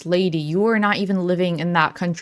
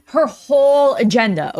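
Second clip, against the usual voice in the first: ordinary talk, nothing marked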